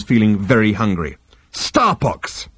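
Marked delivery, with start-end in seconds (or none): 1.5-2.5 contained shout, higher in pitch. A narrator's reading. Hungry man out of patience in his cabin, shouting. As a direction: exclaim it in exasperation. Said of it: "he shouted".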